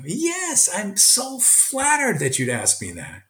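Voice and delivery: very high, slow, gushing; teacher tells his student he's honoured to be asked; flattered. Retold or imitated. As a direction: stretch it out, tickled and flattered. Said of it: "I go".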